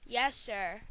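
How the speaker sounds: usual speaking voice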